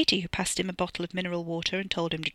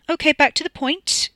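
loud and raised, taking back control; loudly; 10 semitones higher, 7 dB louder than usual. A narrator's reading. Katie pacing, steering back to the point, loudly. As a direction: pull everyone back on topic loudly. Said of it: "Katie said loudly".

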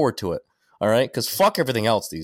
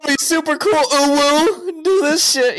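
much higher, louder giddy cheering with a laugh; mock excited squeal; 6 dB louder, slower than usual. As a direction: egg him on like an overeager fan. Mocking the honeypot girl.

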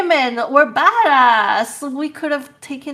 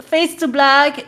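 ordinary talk, nothing marked

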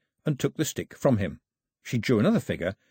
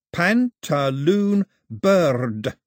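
very slow, higher, louder repeat; slow baby talk; 6 semitones higher, 5 dB louder, much slower than usual. A narrator's reading. Mao copying Daphne's slow baby talk back to her. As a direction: drag out "Pantaloon bird" the way she did. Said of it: "That's how you talk to babies".

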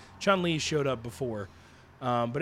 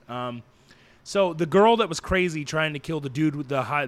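ordinary delivery

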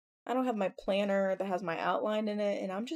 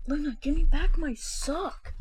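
telling off the dog; 7 semitones higher, 6 dB louder, slower than usual. Real, not acted. Scolding dog over a sock.

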